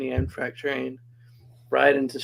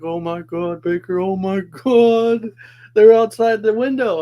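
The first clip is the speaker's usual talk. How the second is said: imitating a panicked friend, rising higher and louder; freaking out; 7 semitones higher, 8 dB louder, slower than usual. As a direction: play the buddy spooked in the dark bunk, voice climbing in panic. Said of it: "start freaking out".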